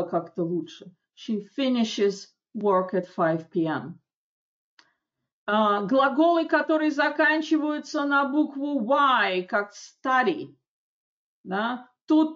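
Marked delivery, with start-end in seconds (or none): none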